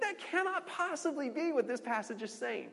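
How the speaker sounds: high-pitched mock disbelief; imagined reader balking in a high voice; incredulous. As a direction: voice an astonished reader objecting, pitched well up. Reacting to a far-fetched interpretation.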